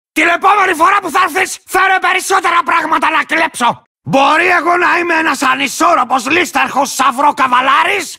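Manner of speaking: belligerent, unhinged warrior taunting his enemies; cantankerous lizard rider. Acted. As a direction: rant like a crazed, land-guarding rider. Belligerent game character taunting enemies.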